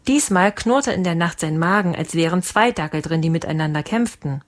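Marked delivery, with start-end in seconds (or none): none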